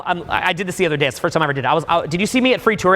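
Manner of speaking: ordinary talk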